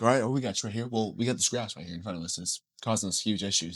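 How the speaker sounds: soft, low acted voice; hushed group spokesman; low-key reply.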